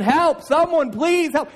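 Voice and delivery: high, loud pleading cry; calling for help again. Acted. Trapped man shouts again for help, loud pleading cry. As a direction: yell for anyone to hear you. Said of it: "the man begins to call out again".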